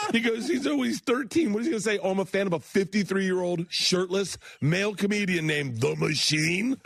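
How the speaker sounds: high, laughing retell. Real, not acted. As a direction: crack up while telling it.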